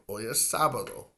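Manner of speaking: ordinary delivery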